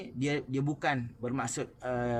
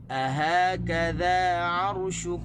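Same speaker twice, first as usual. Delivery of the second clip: slow and louder, stress on "ha" and "kaf"; "Ahakadha" slowly, hitting "ha" and "ka"; correct stress; 3 semitones higher, 5 dB louder, much slower than usual. Shown as a demonstration. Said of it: "tekan di ha dan tekan di kaf". (tekanan pada ha dan kaf)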